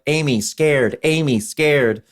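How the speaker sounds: put-on slow, high childlike gorilla voice. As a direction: speak like a simple scared gorilla. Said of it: "Amy's sitting on the back, like".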